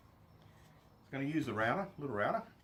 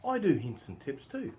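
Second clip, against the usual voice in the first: slower, higher and louder, with mock pride; tongue in cheek; 6 semitones higher, 7 dB louder, slower than usual. Shown as a demonstration. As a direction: deliver it like a proud boast you don't believe. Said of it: "put my tongue in my cheek".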